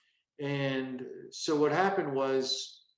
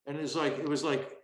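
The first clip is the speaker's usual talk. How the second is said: ordinary talk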